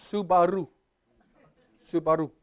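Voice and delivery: Subaru said evenly, no stressed syllable; flat Subaru. Shown as a demonstration. Pastor repeating the correct Japanese Subaru, even. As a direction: repeat Subaru level and light. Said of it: "That's the correct way".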